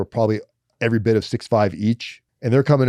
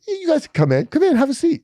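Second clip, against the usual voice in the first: high, sweet mock-friendly guard; nice guard. Front desk guard welcomes him, high sugary friendliness. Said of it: "he was really nice".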